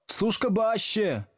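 high, slow mock shout; heckling call. A narrator's reading. Pupils heckling from the back desks, high slow call. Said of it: "крикнули с задних парт".

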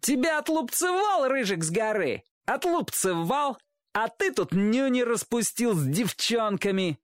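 put-on high, jeering kid voice; shrieky-high playground taunt; taunting boy. A narrator's reading. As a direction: tease him like a bratty schoolboy. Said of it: "передразнил его мальчишка".

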